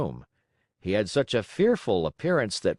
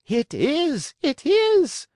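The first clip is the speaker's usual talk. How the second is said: very high, loud shrieked It is; 6 dB louder, much slower than usual. A narrator's reading. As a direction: squeal it in delight. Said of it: "shrieked Signora Rosaura".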